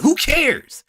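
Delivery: ordinary delivery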